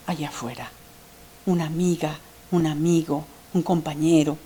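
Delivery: hushed list of friends; quiet. Real, not acted. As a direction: sink into a soft murmur. Soft listing of outside support.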